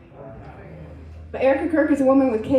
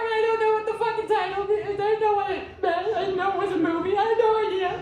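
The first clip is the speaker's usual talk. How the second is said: usual speaking voice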